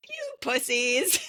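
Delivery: slow, teasing jab; drawn-out taunt at her boys; mocking mother. Mother laughing at her sons on the phone, slow mocking taunt. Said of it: "And she's like".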